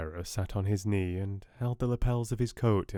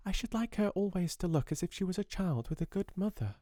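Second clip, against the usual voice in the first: put-on high, soft child's voice; gentle young girl pleading; little girl voice; 10 semitones higher, 4 dB quieter than usual. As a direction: speak as a small earnest girl. Child character voice.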